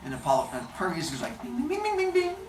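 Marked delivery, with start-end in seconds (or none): 1.4-2.4 high, ringing bing notes; quick tinkling string imitation; imitated lyre playing. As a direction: pluck out bings with the voice. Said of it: "playing this new musical instrument".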